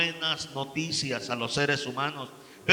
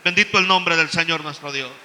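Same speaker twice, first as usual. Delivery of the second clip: loud, ringing pulpit proclamation; fervent preacher calling to the church; full-force preaching; 3 semitones higher, 12 dB louder than usual. Acted. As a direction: preach it out to the congregation.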